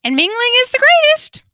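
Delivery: high squawking parrot laugh. A narrator's reading. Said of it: "the parrot laughed into its feathered wing".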